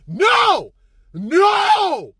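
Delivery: high, drawn-out cries of no; emphatic comic denial. Acted. Storyteller denying he had control, high comic outcry. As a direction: wail the no in mock dismay.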